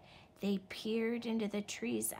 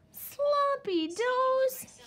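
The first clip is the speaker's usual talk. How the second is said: very high, loud, slow howl; 8 dB louder, much slower than usual. Acted. Little wolf howling at the dinner plate, loud high howl. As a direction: stretch it into a wolf-cub howl of disgust. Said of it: "he howled".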